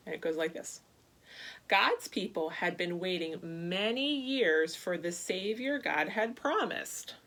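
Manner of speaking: usual speaking voice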